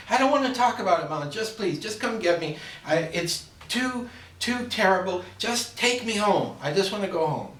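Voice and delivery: melodramatic, near tears over his words; distraught bride. Acted. Bride begging her mother to take her home, high and wailing. Daughter refuses in a joke.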